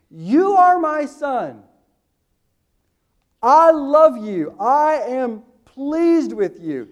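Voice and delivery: loud, slow, majestic declaration; the Father speaking to Jesus from the clouds, slow and booming; booming voice of God. Acted. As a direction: proclaim it slowly, as if from the heavens.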